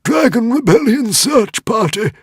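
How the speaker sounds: high, loud panicked shout; alarm call. A narrator's reading. Dragon warning Hiccup of the search party, loud alarm. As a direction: sound the alarm.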